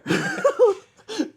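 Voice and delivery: loud, very high-pitched laugh. Real, not acted. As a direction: burst out laughing. Cracking up at own retelling.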